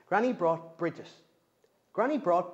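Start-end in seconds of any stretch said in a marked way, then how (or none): none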